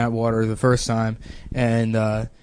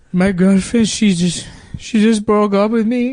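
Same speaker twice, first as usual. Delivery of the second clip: put-on high, halting Mexican-accented lament; mock-tearful heartbreak, louder and higher as it goes; about to cry; 8 semitones higher, 4 dB louder than usual. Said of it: "then he starts crying on my shoulder".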